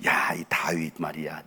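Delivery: normal talking voice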